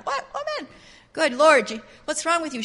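high-pitched mock scolding. Townsfolk slapping Rahab down, mock scolding. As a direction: play indignant neighbours telling someone off. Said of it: "they're slapping her down".

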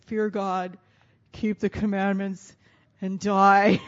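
booming doom-laden pronouncement; loud, heavy voice of despair; grim mock proclamation. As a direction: proclaim it like a gloomy prophet. Quoting Ecclesiastes' grim ending.

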